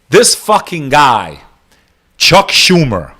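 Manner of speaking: slow and scornful, leaning on "This"; slow disgusted emphasis. Real, not acted. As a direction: drag out "This fucking guy" slowly, each word weighted.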